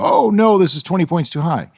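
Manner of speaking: sudden high "oh no"; startled by a bad reading; dismayed. Retelling reading a bad measurement.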